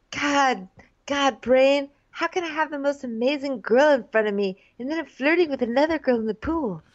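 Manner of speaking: high, anguished mock soul-searching. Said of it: "Yeah, you're so deep".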